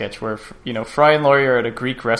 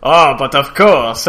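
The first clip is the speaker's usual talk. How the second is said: slow, louder hammed-up French accent; mock French accent, pushed out loud and slow; cheesy French accent; 7 dB louder, slower than usual. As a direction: ham up a stage French accent, drawing it out. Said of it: "reading him in a cheesy French accent".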